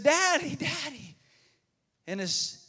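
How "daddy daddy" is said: put-on high, scared little boy calling; child calling daddy. Boy calling his dad about the snake. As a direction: call out like a small child. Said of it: "He said".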